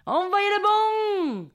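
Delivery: high, slow southern French holler; hammed-up southern French accent. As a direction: bellow a bad imitation of Marseille accent. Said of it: "Je prends mon plus bel accent du Sud". (faux accent du Sud, crié et traînant)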